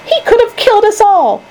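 very high, outraged cry; restrained scream. A narrator's reading. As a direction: wail the accusation, keeping volume contained. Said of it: "screamed Grandma Josephine".

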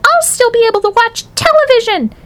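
put-on tiny falsetto boy, very high; squeaked. A narrator's reading. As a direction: squeak it defiantly. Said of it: "squeaked the tiny voice".

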